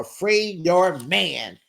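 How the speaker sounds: slow, drawn-out echo at normal volume; mock solidarity. Friend backing up the crying girlfriend, slow mock solidarity. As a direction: stretch each word in playful support.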